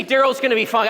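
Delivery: loud firm pep talk to yourself; a loud, joking self-reassurance; reassuring self-talk. Shown as a demonstration. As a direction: say it like you believe it. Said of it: "Just, just say".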